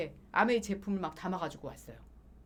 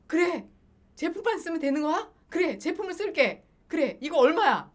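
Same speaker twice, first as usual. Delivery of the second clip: much higher and louder, eager; delighted, relieved agreement; eager relief; 8 semitones higher, 7 dB louder than usual. Retold or imitated. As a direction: jump at the offer with relief.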